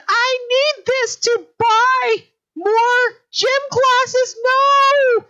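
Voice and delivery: high, loud, slow squeal; terrified employee swallowed by a pudding, squealing high and loud; panicked darkling. Acted. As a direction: wail it like a terrified little darkling. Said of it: "And he said".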